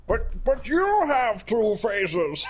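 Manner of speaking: high, slow put-on voice; hammed-up stammering disbelief, stretched out high; mock incredulous character voice, laughing after; imitating baffled character. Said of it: "He's like".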